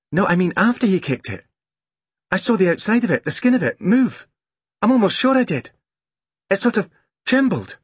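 put-on high, earnest child's voice; excited young girl. A narrator's reading. Girl explaining that she saw it move, excited. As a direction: insist on it in a high girl's voice.

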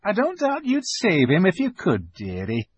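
high motherly voice, sympathetic; kindly beaver wife, pitched well up; Missus Beaver. A narrator's reading. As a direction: gently let the child down.

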